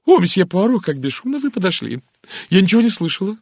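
high, loud flutter of surprise; startled, high-pitched fluster; startled American lady. A narrator's reading. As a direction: exclaim as if caught unawares. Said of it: "американка вздрогнула от неожиданности".

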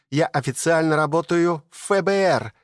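high joyful squeal of good news; визг от радости. A narrator's reading. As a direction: shriek with delight, news of a lifetime. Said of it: "и завизжала от радости". (радостный визг)

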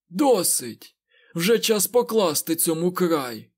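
high, loud cry of fury; angry shout. A narrator's reading. Aginor raises his head in hatred, shouting angrily. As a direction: shout it out, drawing the words out. Enraged Forsaken cries out.

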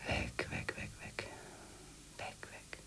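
whispered inner urge to flee, trailing off; desperate whisper. Acted. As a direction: breathe the words, dying away. Fleeing thought, fading inner voice.

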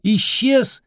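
high yell into the distance; shouting over wind. Acted. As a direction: yell it across the field. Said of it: "заорал".

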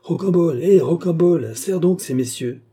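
high, loud grumbling summons; jolted awake, call out crossly for service; calling out loudly, grumbling, pitched high; grumpy startled call. Acted. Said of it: "s'éveilla en sursaut et en maugréant".

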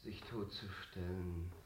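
the breath running out of him; dying, fading words; failing voice. Real, not acted. Actor collapsing, voice failing.

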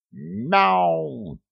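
a high, pained meow from a kicked cat; cat's yelp of pain; startled cat yowl. A narrator's reading. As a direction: yowl as the boot lands. Cat gets kicked mid-story.

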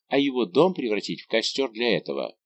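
plain reading voice

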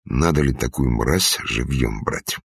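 usual speaking voice

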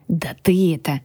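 lightly grumbling little dragon; grumbled. A narrator's reading. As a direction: mutter it as a small dragon. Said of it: "буркнул Грю". (буркнуть по-драконьи)

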